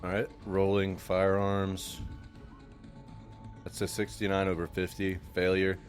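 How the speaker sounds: normal talking voice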